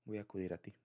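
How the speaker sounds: usual speaking voice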